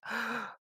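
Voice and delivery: sharp high surprised gasp; delighted gasp. Retold or imitated. DM amazed the player knew, gasping. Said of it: "And I'm like".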